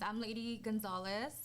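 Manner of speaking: ordinary delivery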